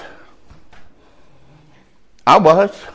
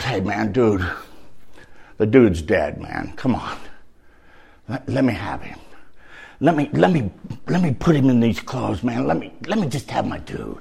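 softer, lightly voiced buddy asking for the body; mild pleading friend; a hint of a pleading buddy; 4 semitones lower, 7 dB quieter than usual. Soldier buddy voiced quietly.

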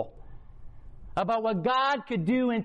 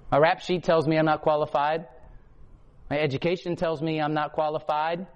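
lower, measured preaching list; preaching cadence; 5 semitones lower than usual. Real, not acted. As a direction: lay each line down like a charge.

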